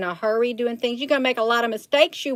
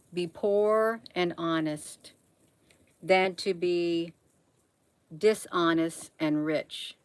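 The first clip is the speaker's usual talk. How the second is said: slow, softer, each word weighed; slow emphatic repeat; 3 semitones lower, 5 dB quieter, slower than usual. Real, not acted. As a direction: spell it out slowly so it sinks in. Repeats the proverb slowly for emphasis.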